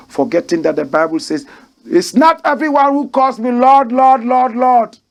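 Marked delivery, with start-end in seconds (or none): 1.9-5.0 high, loud scripture quotation; raised preaching voice. Acted. Host quoting the Bible against those saying Lord, raised preaching. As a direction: call it out high and loud like a rebuke.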